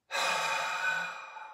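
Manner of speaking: long audible breath blown out through the mouth; long exhale. Shown as a demonstration. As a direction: let all the air out in one slow sigh. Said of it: "You blow it out through the mouth".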